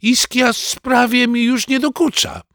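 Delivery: very high-pitched granny voice; grandmother's high, airy assurance; reassuring grandmother. Acted. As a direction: insist in a high voice that all is fine.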